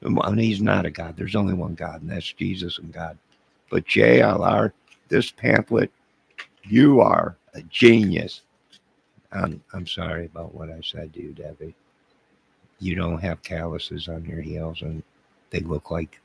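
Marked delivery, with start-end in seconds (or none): 3.7-8.4 loud, slow, higher hero worship; fanboy awe for the pamphlet writer; gushing praise. Acted. 9.3-11.7 much softer mumbled sorry; meek apology to Debbie; sheepish apology. Acted.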